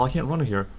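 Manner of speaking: ordinary talk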